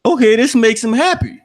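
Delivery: high-pitched imitation of a puzzled doll; doll's naive reasoning. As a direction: voice Chucky working it out innocently. Chucky's naive reasoning acted out.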